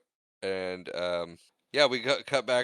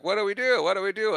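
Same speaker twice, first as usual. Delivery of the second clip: high, loud helpless plea; imitating flustered panic, pitched way up on both questions; mock panic; 9 semitones higher, 5 dB louder, faster than usual. Heroes bogged down in a hallway, mock panic.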